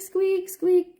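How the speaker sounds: high, loud squeak noise; door squeak imitation. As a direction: squeal it like the hinge.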